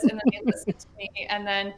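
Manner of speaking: normal talking voice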